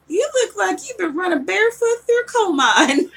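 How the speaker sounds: put-on teasing the boyfriend about dirty feet, laughing country jab. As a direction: rib him like a country girl. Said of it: "saying real country things".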